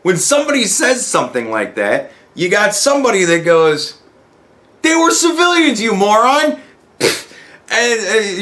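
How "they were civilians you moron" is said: high-pitched indignant snap; hammed-up outrage at a fool; mock angry retort. Someone online scolding a crank, mock angry high.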